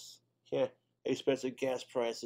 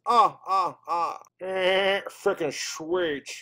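normal talking voice

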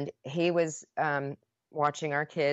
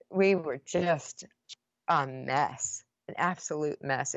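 usual speaking voice

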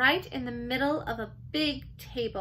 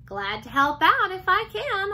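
high, louder hamster voice; squeaked; 7 semitones higher, 5 dB louder than usual. A narrator's reading. Hamster offering the girl help, squeaking. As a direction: pipe up like an eager little hamster. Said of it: "I squeaked to her".

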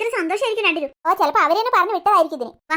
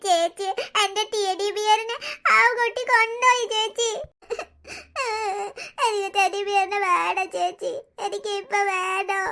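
slow, tearful plea for the teddy; a hurt child crying for his toy; slow whimpering plea; slower than usual. Acted. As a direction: beg like a sobbing little boy. Boy whimpers for lost teddy.

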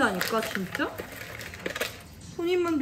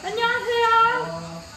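slow, bright welcome; warm greeting; 8 semitones higher, 5 dB louder, much slower than usual. Real, not acted. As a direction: greet the in-laws warmly. Greeting arriving parents.

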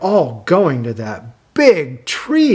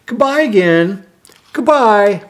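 light character goodbye; 5 semitones higher, 4 dB louder than usual. Acted. Dog characters saying goodbye.

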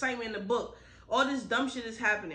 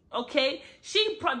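usual speaking voice

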